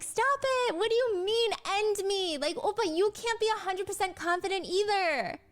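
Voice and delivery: joking angry, like a pouting idol scolding oppa; cute aegyo voice. Retold or imitated. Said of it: "She's still using her aegyo voice".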